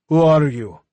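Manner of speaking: loud, carrying call into the dark. A narrator's reading. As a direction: shout it out to find him. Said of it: "he called out".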